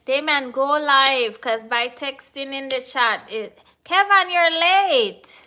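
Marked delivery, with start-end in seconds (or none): 3.8-5.4 loud, high call to a latecomer. Real, not acted. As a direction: call Kevin out for being late. Calls out a latecomer in chat.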